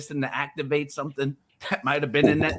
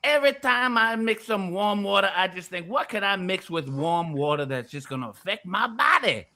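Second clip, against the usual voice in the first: put-on country accent climbing into a high rant; 7 semitones higher than usual. Pink salt seller testifying to Vic, high country voice. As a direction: build a hammed-up country sales rant. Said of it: "I'll do it in the country voice now".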